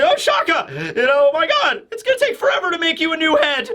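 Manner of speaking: high, laughing, hurried complaint; mock exasperation. Vegapunk grumbling about rebuilding Shaka's head, high laughing mock complaint. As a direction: joke it like a fussy inventor sighing over repairs. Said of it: "just like".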